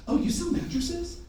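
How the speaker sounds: high, soft questioning voice; mock-innocent rising question; surprised guest. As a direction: lift it high, lightly, as a puzzled customer.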